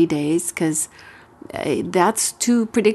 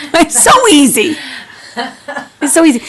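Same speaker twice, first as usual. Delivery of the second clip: real laugh through the words, louder; 7 semitones higher, 7 dB louder than usual. Real, not acted. Host laughing with the co-host about the easy step. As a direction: giggle it out, delighted.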